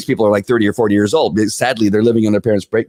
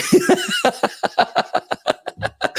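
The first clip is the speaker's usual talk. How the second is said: a sudden squealing laugh, much higher; 4 dB quieter than usual. Real, not acted. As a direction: burst out in a high-pitched cackle. Laughing at his own mock pity.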